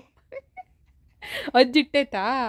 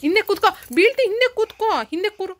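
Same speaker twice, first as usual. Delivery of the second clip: urgent warning; 6 semitones higher, 4 dB louder than usual. Real, not acted. Warning child on the bike.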